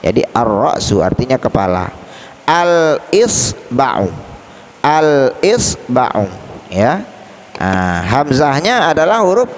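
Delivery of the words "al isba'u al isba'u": very slow "Al-isba'u", l distinct; clearly read al. Shown as a demonstration. Teacher models the example twice, "Al-isba'u" with clear l. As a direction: separate al, is and ba'u in "Al-isba'u". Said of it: "alnya dibaca dengan jelas".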